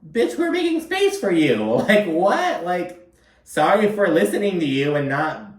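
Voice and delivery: very high, shrill put-on voice; mock outraged Uba. Vlogger imitates Uba venting about Brynn, very high put-on voice. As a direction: squeal it like you're offended.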